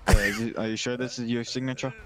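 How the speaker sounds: ordinary talk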